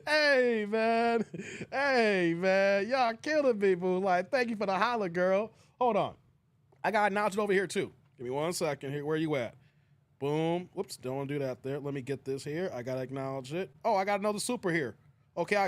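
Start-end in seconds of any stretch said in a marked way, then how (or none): none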